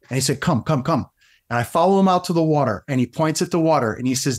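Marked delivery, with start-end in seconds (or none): 0.4-1.1 a hint of a Russian accent; Igor's Russian accent. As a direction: beckon as a lightly voiced Russian mentor. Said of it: "I'm trying with a Russian accent".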